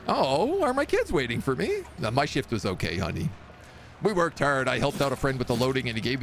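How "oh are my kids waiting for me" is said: high, bright mock delight; delighted husband. Father hears the kids waited, hamming up high delight. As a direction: gush with exaggerated delight.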